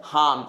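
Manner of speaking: "हार्म" said as "haam", no "r"; dropped R. Shown as a demonstration. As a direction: let the "r" vanish from "हार्म". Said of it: "last में क्या आ जा रहा है". ("हार्म" को "हाम" बोलना)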